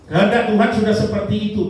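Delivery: loud, high and quick declaration; emphatic preaching. Real, not acted. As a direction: proclaim it forcefully to the congregation, pitched high. Repeats it forcefully to the congregation. (menegaskan dengan lantang dan bernada tinggi)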